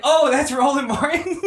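high, slow "oh" of surprised realisation; host retells recognising the voice, in high surprise; sudden recognition. Retold or imitated. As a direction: act out catching on with a high, stretched surprise. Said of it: "And I was like".

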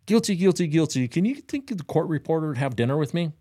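high, eager rapid-fire guilty; hammed-up eager plea before the judge.

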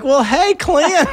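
high, exaggerated North Carolina twang; mocking North Carolina accent. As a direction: lovingly tease with a hammed-up North Carolina accent. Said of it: "would make fun of me".

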